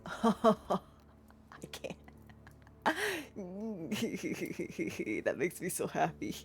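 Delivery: soft giggle running into the words; real tickled giggle; delighted giggling. Real, not acted. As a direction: laugh happily as you say it. Giggling at her chosen name.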